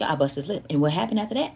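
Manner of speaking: usual speaking voice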